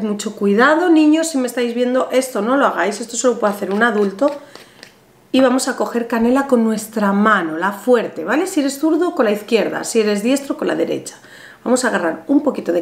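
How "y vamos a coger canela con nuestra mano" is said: ordinary talk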